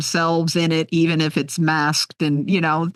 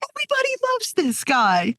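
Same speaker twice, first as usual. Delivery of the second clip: amused thought. Retold or imitated. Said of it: "I'm like".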